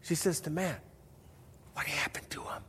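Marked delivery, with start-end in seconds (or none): 1.7-2.6 hushed whispered question. Retold or imitated. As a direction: whisper it so the brother can't hear. Kid sister asks brother quietly.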